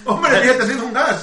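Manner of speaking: amused teasing laugh. Real, not acted. Teasing a friend about gas.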